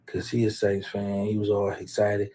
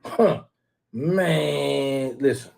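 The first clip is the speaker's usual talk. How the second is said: ordinary talk, nothing marked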